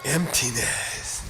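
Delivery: drawn-out word. Shown as a demonstration. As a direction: stretch the word out solemnly. Intones the topic for dramatic effect.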